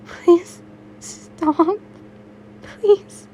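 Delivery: high, pleading through tears; desperate crying plea. Acted. Uraraka begging her attacker to stop, crying high. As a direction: beg him to stop, voice breaking high. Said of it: "They heard crying".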